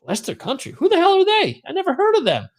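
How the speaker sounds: high mock disbelief; incredulous high-pitched bewilderment; baffled. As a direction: act totally baffled by a team you've never heard of. Baffled at unknown team.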